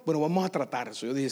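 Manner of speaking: ordinary talk, nothing marked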